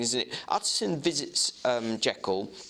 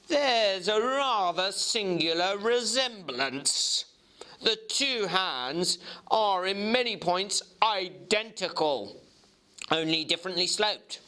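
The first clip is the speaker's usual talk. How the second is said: clerk Guest; 7 semitones higher than usual. Acted. Guest explains resemblance.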